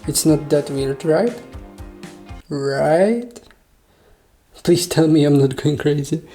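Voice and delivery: normal talking voice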